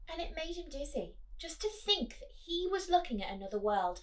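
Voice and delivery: put-on reading to children. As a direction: draw the kids into the story. Reading picture book aloud.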